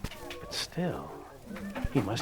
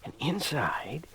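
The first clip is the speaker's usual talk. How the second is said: hushed storyteller whisper; breathy secret whisper; 4 semitones lower, 9 dB quieter than usual. A narrator's reading. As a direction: whisper it to build suspense. Narrator hushes for suspense.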